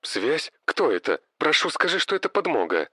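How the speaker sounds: shaky, desperate plea over the radio; trembling voice. Acted. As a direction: beg for help, voice shaking. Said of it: "Голос на той стороне дрожал".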